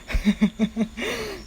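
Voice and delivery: high laugh. Real, not acted. Host recalls old adventures, high laugh. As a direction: burst of helpless giggling. Cracks up at own story.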